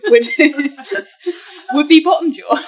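loud, high laugh through the words. Real, not acted. Host revealing a silly nickname to friends, cracking up. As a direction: crack up as you get the punchline out.

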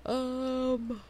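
yawning through the word. Real, not acted. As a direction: say it mid-yawn. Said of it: "I'm yawning".

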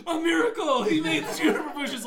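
very high, loud, laughing rapture; ecstatic crowd. Retold or imitated. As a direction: hail the miracle in rapture. Crowd hails a miracle.

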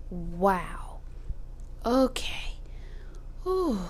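wincing in pain; stomach cramps. Real, not acted. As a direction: gasp through the cramp. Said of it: "I'm cramping".

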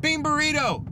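a high, playful mock yell of "Bean burrito!". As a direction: blurt the order out to fill the silence. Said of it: "And so I just yelled out".

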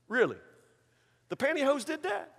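soft, high sceptical question; mock incredulous. Preacher answering the robber's excuse, incredulous.

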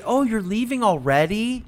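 high, slow, drawn-out fake dismay; mock disappointed host. Mimicking a host guilt-tripping a departing guest, drawn-out. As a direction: stretch it out like a clingy host. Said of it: "You know, I hate".

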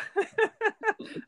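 bright rising giggle; high laugh. Real, not acted. Laughing at family clumsiness.

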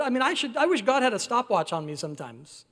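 ordinary talk, nothing marked

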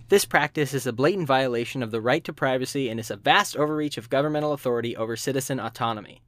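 ordinary talk, nothing marked

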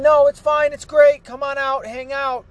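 high, loud, mocking sing-song of a politician brushing off danger; mock Nancy Pelosi. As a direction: parody her as carefree and breezy, pitched well up. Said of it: "Nancy Pelosi ... going".

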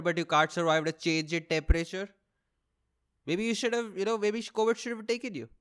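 usual speaking voice

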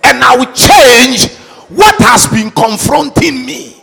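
shouted rallying declarations; booming motivational speech. Acted. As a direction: rouse the crowd at full voice. Leads loud declarations.

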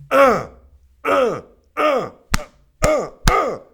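high, punchy repeated grunts; hype grunts. Real, not acted. Host celebrating King Henry, hype grunts. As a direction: grunt it out in sharp bursts.

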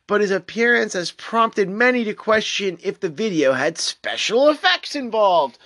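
ordinary talk, nothing marked

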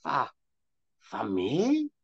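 rising, touched crone; can't believe the gift; surprised. Acted. Crone surprised to be offered a sweet. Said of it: "asked the crone in surprise".

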